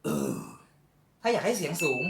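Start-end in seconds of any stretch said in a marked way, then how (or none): 0.0-0.3 very low, open-mouthed throat grunt; low throat bass. Shown as a demonstration. Teacher demonstrates the low throat bass tone. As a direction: drop it deep into the throat. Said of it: "ถ้าท่านอยากให้มันเป็นเสียงต่ำ".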